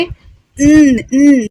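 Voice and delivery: उम as a held "nnn"; tongue tip between the teeth, hum उम; two isolated N hums for उम, उम. Shown as a demonstration. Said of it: "जबान की नोक को दांतों के बीच में रख के".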